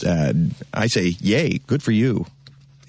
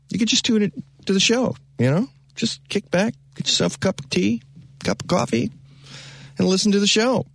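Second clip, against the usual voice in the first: relaxed coaxing; 10 semitones higher than usual. Real, not acted. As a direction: lull them into kicking back.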